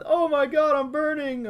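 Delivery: squeaky high scream; high-pitched panic, burning; high panicked voice. Retold or imitated. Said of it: "he also did a lot of, like".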